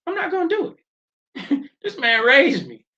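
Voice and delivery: high, loud, laughing defence of dad; heated protest. Real, not acted. Defending stepfather, voice jumps high.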